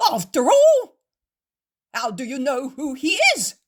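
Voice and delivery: loud, high furious accusation; shouting. A narrator's reading. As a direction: tear into the innkeeper with outrage.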